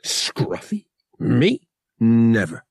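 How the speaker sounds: loud, punchy pretend offence; hammed-up wounded pride; mock umbrage. A narrator's reading. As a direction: play indignant, one word at a time. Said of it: "pretending to take umbrage".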